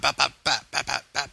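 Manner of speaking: quick high popping gunshot noises; rat-a-tat mouth-made shots; imitated gunfire. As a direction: pop out rapid shots, pitched well up. Imitating rapid headshots.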